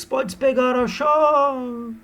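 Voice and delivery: high, very slow, stretched-out tease; hammed seductive lady. Aurélia offering the key to Seixas, high slow mock seduction. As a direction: draw it out like a tipsy temptress.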